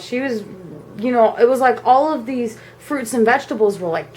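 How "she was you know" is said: usual speaking voice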